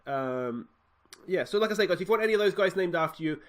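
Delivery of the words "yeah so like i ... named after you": higher, faster channel-host voice; youtuber hype. Acted. Host tells viewers about naming youth players, higher and brisk. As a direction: pitch it to the viewers.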